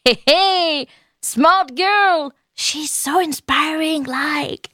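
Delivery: put-on smug self-praise in an English accent. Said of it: "Vous avez vu mon bel accent anglais?".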